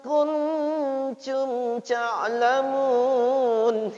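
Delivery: "kuntum ta'lamun" sung slowly with excess air; over-breathe every letter; overdone hiss. Shown as a demonstration. Teacher mocks the student's overdone hiss, slowly chanted. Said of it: "Gitu, gitu".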